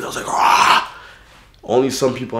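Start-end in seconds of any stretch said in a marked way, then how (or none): 0.3-0.9 loud unvoiced mock scream "Ah!"; retold scream. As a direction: let out a short battle yell. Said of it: "I screamed".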